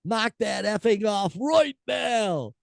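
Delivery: high, slow mock scolding; furious singer. Host reads furious singer's words, high mock scolding. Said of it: "she exclaimed".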